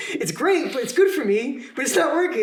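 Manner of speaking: laughing through the words. Real, not acted. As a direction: laugh at your own bad luck. Laughing at own situation.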